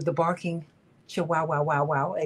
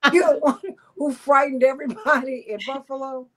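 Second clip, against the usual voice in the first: laughing through the words; 7 semitones higher, 4 dB louder than usual. Real, not acted. Host teasing the little powerhouse, laughing. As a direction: tease her while laughing.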